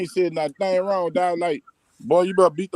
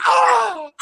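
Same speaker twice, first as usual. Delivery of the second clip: pained cry; 5 dB louder than usual. Retold or imitated. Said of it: "I was like".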